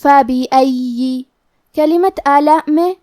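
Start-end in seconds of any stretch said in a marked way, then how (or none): 0.0-1.2 slow recited "فبأي" with ي doubled, ending in i. Shown as a demonstration. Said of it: "یا کو زیر سے بھی پڑھیں گے".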